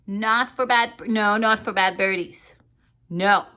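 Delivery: loud firm scolding of a pet bird; loud stern refusals; firm repeated no. Real, not acted.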